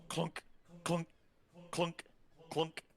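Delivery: clunk said high like heavy steps; footstep sounds. A narrator's reading. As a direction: voice the slow footfalls. Narrator voices heavy footsteps.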